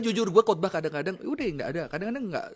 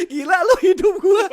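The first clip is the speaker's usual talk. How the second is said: high, loud, laughing exclamation; laughing outburst; 12 dB louder than usual. Retold or imitated. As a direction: imitate a young person, loud and high through laughter. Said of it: "Dia bilang".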